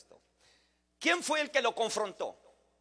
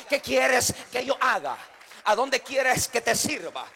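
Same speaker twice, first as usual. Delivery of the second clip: slow, louder, pleading offer; slow, louder plea to God to be sent to serve; earnest plea; 2 semitones higher, 6 dB louder than usual. Acted. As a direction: ask it slowly like a servant ready to obey. Voicing a surrendered servant's plea.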